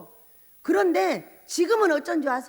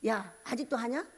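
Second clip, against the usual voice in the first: sneering; 3 semitones lower, 9 dB quieter than usual. Retold or imitated. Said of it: "비아냥대면서".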